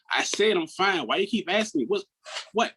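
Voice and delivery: high-pitched, irritated comeback; retold aggressive retort. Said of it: "I became really aggressive".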